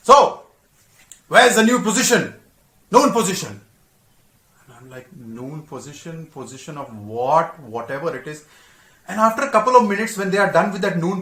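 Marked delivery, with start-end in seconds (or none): 0.1-3.6 captain's demand. Retold or imitated. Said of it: "the captain comes and says". 5.4-8.5 baffled mutter. Retold or imitated. Baffled cadet muttering to himself.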